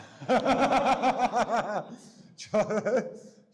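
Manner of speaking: long, high burst of laughter; loud laugh. Real, not acted. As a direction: crack up out loud.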